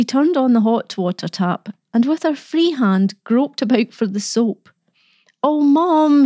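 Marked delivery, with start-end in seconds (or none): 5.4-6.2 put-on high, drawn-out boyish wail. A narrator's reading. Boy being dragged to the sink, wailing. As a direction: wail in protest to a parent. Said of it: "he wailed".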